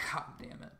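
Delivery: hushed, breathy groan of frustration under the breath; exasperated mutter. Retold or imitated. Hearing people say he has a country accent, muttered exasperation. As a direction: mutter it to yourself, fed up. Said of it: "I'm like".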